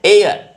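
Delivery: एयर with a silent h, said high and loud; एयर voiced as a loud, emphatic model; heir said as air. Shown as a demonstration. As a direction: say एयर like "air", never "hair". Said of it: "इसको आप हेयर नहीं बोलेंगे".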